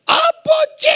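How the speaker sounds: high desperate shout to God; crying out. Retold or imitated. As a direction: cry out to the Father. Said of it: "울부짖으면서 기도했는데". (기도원에서 하나님께 울부짖듯 높게 외쳐)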